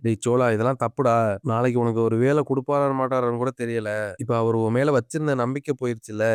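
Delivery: plain reading voice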